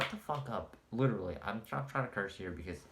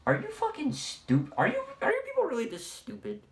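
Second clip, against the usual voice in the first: much higher and louder, disbelieving yell; exasperated shout; 6 dB louder than usual. Real, not acted. Ranting at Laker fans, a real exasperated shout. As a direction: blow up at the stupidity.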